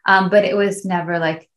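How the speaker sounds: ordinary talk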